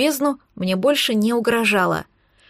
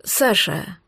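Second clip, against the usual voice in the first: lower, strained address; tense tone; 4 semitones lower than usual. A narrator's reading. Young man breaks bad news to girl, tense low voice. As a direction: say the name low with tension. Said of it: "произнёс Ярослав напряжённым тоном".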